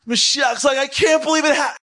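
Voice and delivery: very high, loud shouted disbelief; mock shout of joy. Imagined Mashiach cheering the speaker, high loud shout. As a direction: explode with comic amazement.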